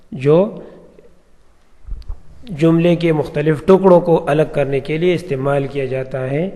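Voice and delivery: ordinary delivery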